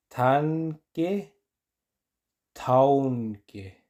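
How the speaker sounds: Tanque then taunque said slowly; teacher contrasts the two slowly. Shown as a demonstration. Slow contrast.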